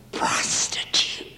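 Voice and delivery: breathy whisper of one shameful word. Retold or imitated. Telling Dick what the girl confessed, whispered. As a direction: whisper it, unable to say it aloud. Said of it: "I had to whisper it".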